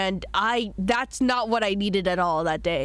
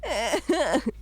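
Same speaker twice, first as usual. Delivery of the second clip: embarrassed laughing; 10 semitones higher, slower than usual. Real, not acted. Embarrassed she was heard unmuted.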